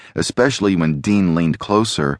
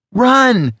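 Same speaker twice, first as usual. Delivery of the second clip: high loud sharp command; urgent hiss; 5 dB louder than usual. A narrator's reading. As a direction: order him to run. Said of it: "Alex hissed".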